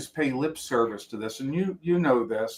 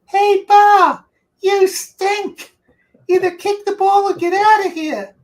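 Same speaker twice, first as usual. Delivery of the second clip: much higher and louder yelling impression; imitating a screeching coach; 8 dB louder than usual. Player imitating coach yelling across practice field, high loud screech. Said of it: "that voice come screeching over the wind".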